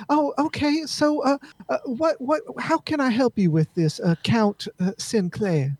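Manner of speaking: high-pitched, hesitant host; game character in polite conversation. Acted.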